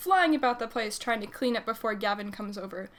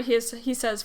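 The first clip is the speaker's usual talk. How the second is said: usual speaking voice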